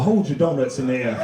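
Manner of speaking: very low preacher voice; pastor impression. Pastor instructing his congregation, low solemn voice. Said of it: "the pastor go up".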